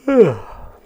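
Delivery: loud high yawn; big yawn. Real, not acted. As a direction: let out a wide, noisy yawn. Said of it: "Sorry, I keep yawning".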